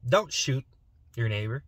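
normal talking voice

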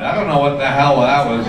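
ordinary talk, nothing marked

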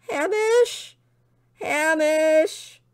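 high, drawn-out cries across distance; despairing calls. A narrator's reading. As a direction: call a lost dog with fading hope. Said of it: "his despairing cries echoing over the landscape".

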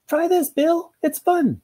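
very high, chirpy coaxing voice; high and enthusiastic; keen owner. Acted. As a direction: coax eagerly in a high-pitched character voice. Keen owner coaxing her bored dog.

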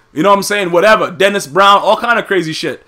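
ordinary delivery

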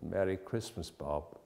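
mean every word of it; earnest. A narrator's reading. Scrooge sincerely wishing Bob a merry Christmas. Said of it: "with an earnestness that could not be mistaken".

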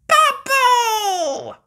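very high, loud joyful squeal; George squeal. Acted. George succeeding at last, high squealing. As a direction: shriek it like a proud toddler. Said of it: "says George".

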